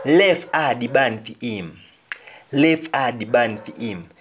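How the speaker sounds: "Leave a the bone for him" in Jamaican patois, "leave" said "lef"; patois line, "lef a di ban"; patois example sentence. Shown as a demonstration. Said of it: "Leave all the bones for it".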